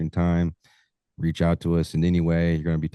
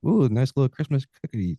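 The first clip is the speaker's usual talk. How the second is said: higher, playful put-on voice; a hint of cooing, raised pitch; mock delight; 7 semitones higher than usual. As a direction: tease it like a treat you found. Mock reaction in the deer story.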